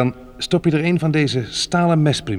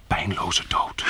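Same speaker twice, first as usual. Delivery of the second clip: whisper; 6 semitones lower, 5 dB quieter, much slower than usual. Acted. Quiet over the dead body.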